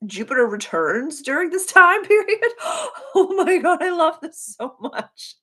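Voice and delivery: high and laughing, overjoyed; high laughing gush; laughing delight. Real, not acted.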